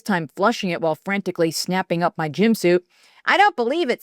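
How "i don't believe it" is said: yell. A narrator's reading. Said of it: "Cindy yelled over the flushing".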